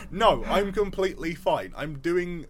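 insistent denial. Retold or imitated. Tearful worker brushing off concerned workmates, insistent denial. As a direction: firmly protest that all is well.

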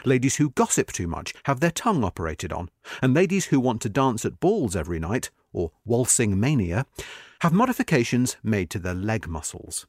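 ordinary delivery

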